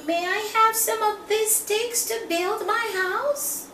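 high squeaky little pig voice, politely asking. Acted. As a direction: play the little pig, small and eager. Pig asks for sticks.

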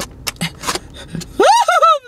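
long high-pitched squeal; hammed-up shriek; high mock wail. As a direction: squeal in pretend panic. Pretends panic over bleeding.

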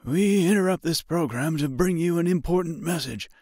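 high muffled emergency broadcast; muffled radio voice. A narrator's reading. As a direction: read like a crackly news bulletin. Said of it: "followed by a muffled voice".

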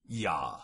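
ja said "yah"; ja opening on a Y. Shown as a demonstration. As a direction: say German ja with a Y sound. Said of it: "where J is pronounced with a Y".

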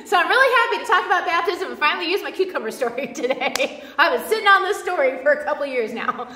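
real laughter running through the words; laughing delight. Real, not acted. Preacher finally using a long-saved story, laughing. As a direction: talk happily through your own chuckles.

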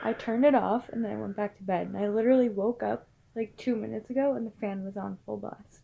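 hushed. Real, not acted. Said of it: "scared right now".

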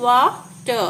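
"Water" broken slowly into two beats; two separate syllables. Shown as a demonstration. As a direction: pause in the middle of "water". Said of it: "ടു syllable words".

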